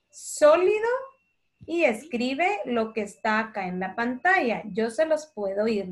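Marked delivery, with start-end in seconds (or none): 0.1-0.9 sólido said higher and louder, one clear word; stressed dictation word. Shown as a demonstration. Teacher dictating to the class, stressing sólido. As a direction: pronounce sólido high and loud, syllable by syllable. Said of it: "Entonces escriba".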